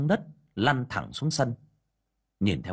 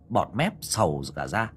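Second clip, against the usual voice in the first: ordinary delivery, nothing marked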